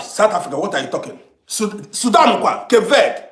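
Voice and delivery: ordinary talk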